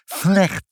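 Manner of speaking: "Slecht" high and loud with a slurred first "s"; miserable lisp. Acted. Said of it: "Ik kan de F niet zeggen".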